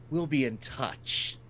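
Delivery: quiet villain farewell. Acted. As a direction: leave them with a soft threat. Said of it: "and then he says".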